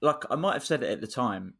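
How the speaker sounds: ordinary delivery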